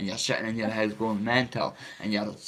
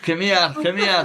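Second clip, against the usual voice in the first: high, loud, drawn-out retold call; urgent calling out; 7 semitones higher, 6 dB louder than usual. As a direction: re-enact calling after something getting away. Retold calling to hallucinated tablets.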